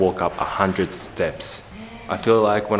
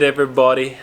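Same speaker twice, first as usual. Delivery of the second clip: usual speaking voice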